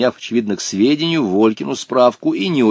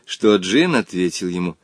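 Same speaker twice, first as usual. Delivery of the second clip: plain reading voice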